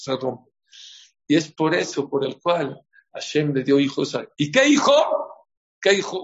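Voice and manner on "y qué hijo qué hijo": high, loud rhetorical question; excited question. Real, not acted. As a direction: throw the question out to the room. (pregunta emocionada, aguda y fuerte)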